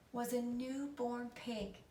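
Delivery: ordinary delivery, nothing marked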